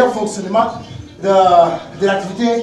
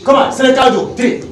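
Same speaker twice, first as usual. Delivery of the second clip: fitness coach counting; 3 semitones higher, much slower than usual. Acted. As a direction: call out reps to the class.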